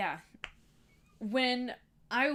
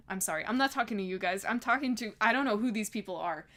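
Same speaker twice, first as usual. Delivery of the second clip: usual speaking voice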